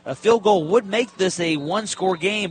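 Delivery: ordinary delivery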